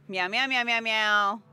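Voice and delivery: quick string of spoken meows; mock cat noises; playful cat meowing. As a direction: meow away like a silly cat.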